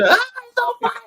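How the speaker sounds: mock scream. Recapping how his wife screamed from a dream, mock panic. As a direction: imitate her panicked shriek at a held-back volume. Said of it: "And she just screamed".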